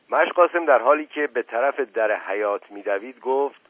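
plain reading voice

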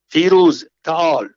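a loud call across the garden. Acted. Samiyeh calls the servant Firuz over, a loud summoning call. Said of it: "را صدا زد".

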